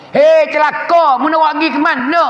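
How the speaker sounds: exclaimed, high-pitched challenge; crying out. Acted. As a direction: call it out high, stretching the first words. Acts out the cry of the corpse.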